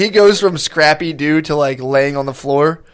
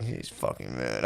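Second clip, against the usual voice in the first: low, much softer, slow grumble; mock drunk grumble; 18 dB quieter, slower than usual. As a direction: mumble it like a sulking drunk. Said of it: "He would just be like".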